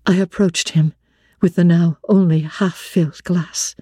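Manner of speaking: ordinary delivery, nothing marked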